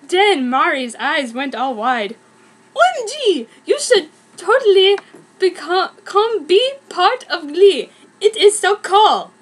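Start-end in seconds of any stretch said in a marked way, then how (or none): 2.7-9.3 put-on squealing excited teen. Mary gushes excitedly in fic.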